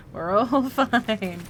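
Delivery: laughing nervously through the words; anxious giggly reassurance. Real, not acted. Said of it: "This is a nervous laugh".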